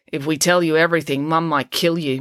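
ordinary delivery

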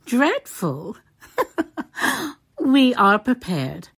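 amused echo breaking into a laugh; smiling, slightly anxious. A narrator's reading. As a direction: laugh it off with a smile, a little anxious underneath. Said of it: "smiling but looking a little anxious".

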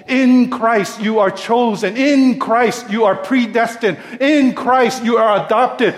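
loud, high, building preacher's litany; preaching at full force. Acted. Preacher hammering In Christ to his church. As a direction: ring out each In Christ to the congregation.

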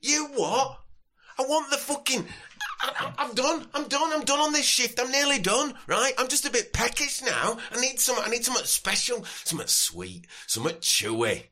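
hammed-up sozzled Yorkshire lad; drunk Yorkshireman. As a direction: drunk Yorkshire festival-goer demanding a wristband. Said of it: "determined slasher from Yorkshire".

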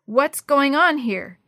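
loud, high, slow demand. A narrator's reading. Tevin's mother yanking open the shed, loudly demanding. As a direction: demand an explanation, stretching the words out. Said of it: "Tevin's mother demanded".

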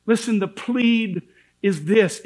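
usual speaking voice

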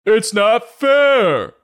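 very high, loud, slow whining; hammed-up grown-up whine; whiny yell. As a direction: wail it like a sulking child. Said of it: "yelled the grown-ups".

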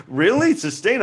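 high, incredulous questioning; hammed-up baffled query; mock disbelief. Reacting to the judge's ruling, mock incredulous.

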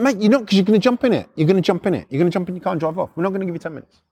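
fast, insistent retort; acted rattled-off protest; rapid objection. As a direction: cut him off with a quick, repeated objection. Acted argument with car owner.

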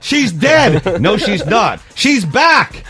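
soap opera melodrama. Pretend. Host parodies Days of Our Lives in mock soap melodrama. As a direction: ham up a daytime soap cliffhanger.

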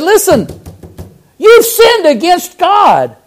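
high, slow warning; prophet's rebuke. Retold or imitated. Nathan confronting David, high slow rebuke. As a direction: deliver the rebuke slowly.

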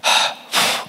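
mock angry exhale through the nose; heavy, put-on huff of anger. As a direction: huff like someone who is mad. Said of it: "when you've been mad".

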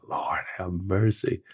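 whispered, slow Lord have mercy; muttered under breath. Retold or imitated. Grandpa to himself over crying grandson, whispered. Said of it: "I was saying that in my mind".

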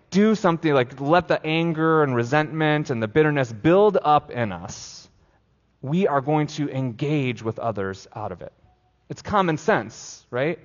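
ordinary delivery

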